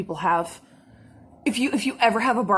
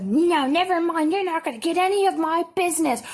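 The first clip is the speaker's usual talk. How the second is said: higher, huffy dismissal; mock angry customer; 7 semitones higher than usual. Caller huffily cancelling her business, imitated in high voice. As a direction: imitate an offended caller brushing someone off. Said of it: "she's yelling at me".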